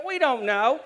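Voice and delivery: ordinary delivery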